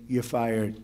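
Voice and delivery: softly, with ironic mock gentleness; mock gentle. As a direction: say the firing sweetly, tongue in cheek. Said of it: "very gently say".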